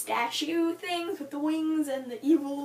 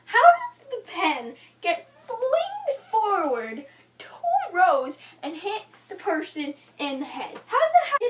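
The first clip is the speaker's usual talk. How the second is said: high, loud mock disbelief; incredulous outburst; 4 semitones higher, 5 dB louder than usual. Reader questioning the flung pen scene, incredulous. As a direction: stretch it out in laughing, exaggerated bafflement.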